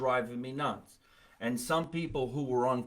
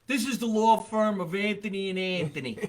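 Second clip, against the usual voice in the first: higher-pitched mobster ad spokesman; hammed-up mobbed-up attorney; mob lawyer pitch; 5 semitones higher than usual. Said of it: "look like they're in the mob".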